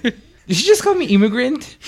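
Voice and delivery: high, laughing disbelief; shocked disbelief. Retold or imitated. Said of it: "got shocked".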